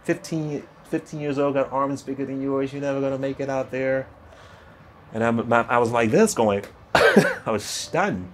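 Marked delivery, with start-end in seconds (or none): 0.0-4.0 mock yell, George. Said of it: "so he's yelling at me".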